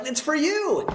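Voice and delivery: a high, light reassurance. Retold or imitated. Speaker handing janitor the money, high light voice. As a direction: toss the line up high and friendly. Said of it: "and I'm like".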